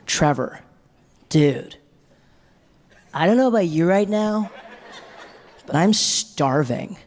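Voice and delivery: put-on drawn-out American accent, dramatic; American friend. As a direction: exaggerate it like a laid-back American. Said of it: "my first American friend".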